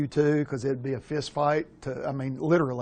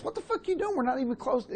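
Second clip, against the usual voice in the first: mock indignant runner; 10 semitones higher, 4 dB quieter than usual. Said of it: "And he goes".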